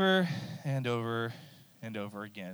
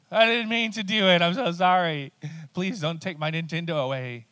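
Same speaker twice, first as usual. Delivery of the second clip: put-on high, loud kid's pleading whine; whining child; 5 semitones higher, 5 dB louder than usual. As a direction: beg like a kid caught out. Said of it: "the cycle of my children".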